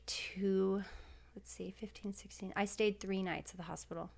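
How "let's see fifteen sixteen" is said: whispered, softer counting to herself; counting under breath. Real, not acted. Guest tallying her hospital days aloud to herself, whispered. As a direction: mouth the numbers in a near whisper.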